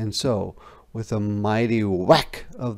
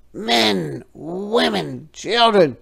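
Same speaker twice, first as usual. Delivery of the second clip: frog; 9 semitones higher, 5 dB louder than usual. A narrator's reading. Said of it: "said the frog".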